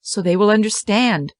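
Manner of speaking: plain reading voice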